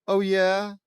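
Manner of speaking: high, loud comeback; one-upping retort. Acted. Luna one-ups Lana.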